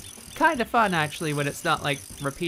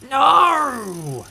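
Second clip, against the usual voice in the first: frustrated shout; 11 semitones higher, 9 dB louder than usual. Real, not acted. Failed game attempt.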